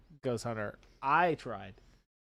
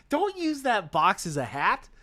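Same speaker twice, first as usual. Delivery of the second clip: ordinary talk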